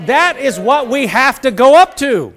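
ordinary talk

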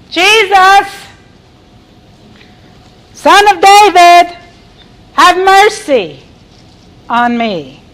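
high, loud, drawn-out plea. Acted. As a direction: shout insistently for mercy, easing at the end. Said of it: "He cried out all the more".

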